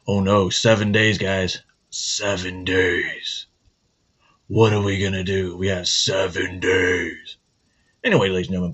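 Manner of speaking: ordinary talk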